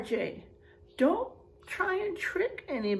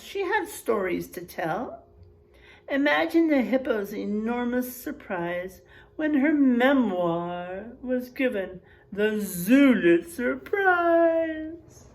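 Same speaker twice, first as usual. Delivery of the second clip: ordinary talk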